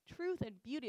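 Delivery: ordinary talk, nothing marked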